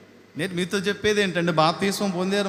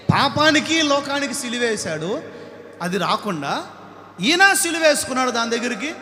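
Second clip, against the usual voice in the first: higher and louder, ending in shouts; full-force preaching; 5 semitones higher, 6 dB louder than usual. Acted. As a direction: hammer each sin, then cry out.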